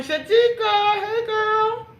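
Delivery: high, loud drawn-out hello; excited greeting. Real, not acted. Greeting a viewer, a high, loud, slow greeting. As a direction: sing out the name with delight.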